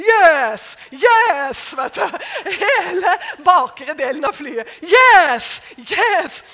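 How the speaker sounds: loud, high cheering shout. Retold or imitated. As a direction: cheer like a whole cabin of supporters. Passengers cheering on the plane.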